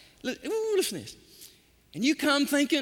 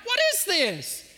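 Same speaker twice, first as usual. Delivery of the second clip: ordinary talk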